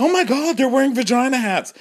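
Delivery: high shocked squeal; mock horror at the pink hats; shocked gasp. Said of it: "People are like".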